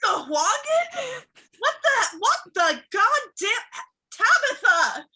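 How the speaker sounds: high, sputtering outrage; sputter, high and appalled; aghast disbelief. Acted. Boss learns of the Sahuagin incursion, aghast.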